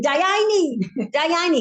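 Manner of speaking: high, sung-out mock yell; playful imitation of yelling; imitated family yell. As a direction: call it out high like the whole table. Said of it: "they're all yell".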